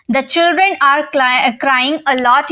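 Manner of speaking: slow model sentence for learners; teacher slowly modelling the English sentence; slow dictation. Shown as a demonstration. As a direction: read it out slowly, word by word. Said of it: "तो आप कैसे बोलोगे?".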